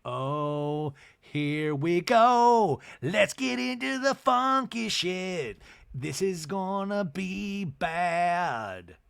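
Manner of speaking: slow, drawn-out "here we go" with a high sneer; dreading sarcasm. Retold or imitated. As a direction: dread the mess that's coming, slowly. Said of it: "And I'm like".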